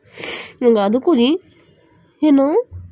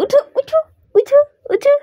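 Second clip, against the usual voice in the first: much higher chirpy get up; high sing-song call. Real, not acted. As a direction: sing him awake brightly. Waking the pet. (উঁচু সুরে গান গেয়ে ডাকা)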